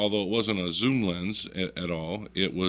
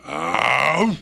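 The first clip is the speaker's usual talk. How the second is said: loud huffing bear bark; sudden animal woof, acted out; imitated bear woof; 3 semitones higher, 7 dB louder than usual. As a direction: huff out a bear's warning. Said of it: "he goes".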